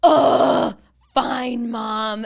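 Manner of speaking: loud, exasperated whiny sigh; grudging groan. Retold or imitated. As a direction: give in like a sulky kid. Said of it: "she's like".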